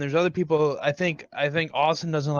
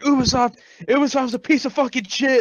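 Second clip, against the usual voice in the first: imitating a friend's rant, higher pitched; retold angry outburst, pitched up; mock raging; 7 semitones higher than usual. Co-host imitates his friend raging at Ubisoft, mock angry. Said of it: "me raging at Ubisoft".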